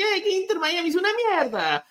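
put-on high-pitched Argentine kid outburst; Argentine accent. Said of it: "he's got his Argentine accent".

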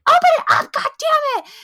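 very high, loud snotty demand; mean girl yelling at Rhonda, high and loud; bratty demand. Retold or imitated. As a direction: screech the order like a spoiled brat.

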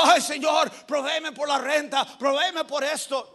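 pleading prayer. Acted. Preacher acts out someone praying for rent, pleading loudly. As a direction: beg out loud like a desperate believer. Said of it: "Usted va orando".

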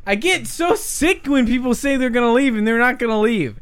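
high-pitched, worked-up complaint; exasperated rant. Acted. Host ranting after kicking Andy out, exasperated. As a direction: vent it, still heated from yelling.

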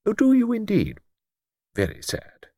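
put-on high, gentle voice of a stooping old clergyman; mild old vicar. Old vicar answering Luke, gently pitched high.

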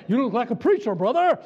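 booming, teasing compliment; jovial loud call. Real, not acted. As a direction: call it out to a man in the crowd. Calling out jokingly to a man.

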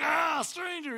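sudden high, loud mock yell; mock scary shout. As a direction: jump out to spook the kid as a joke. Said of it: "'cause I'd probably just scare the kid".

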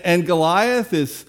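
usual speaking voice

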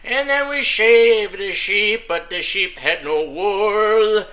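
put-on slow, mournful Irish-accented telling; hammed-up Irish sob story, slow; mock Irish lament. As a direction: drag it out like a sad Irish ballad. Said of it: "I'm talking about the sad, crappy".

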